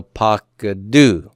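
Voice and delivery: pakadu slowly, with a long final DU; pa-ka-DUU, pakadu by syllables; slow model pronunciation. Shown as a demonstration. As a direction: stretch pakadu out, stress on DU. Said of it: "Say that, pakadu".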